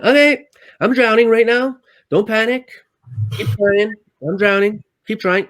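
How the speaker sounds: high, strained panic while drowning; retold desperate self-talk while going under; panicked self-talk. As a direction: talk yourself through it in a panic, loud and high.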